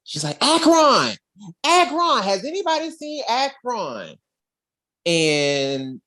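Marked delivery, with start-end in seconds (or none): 0.4-4.1 put-on little girl calling out. Little girl calling out across the castle for Akron. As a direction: shout for Akron like a searching kid.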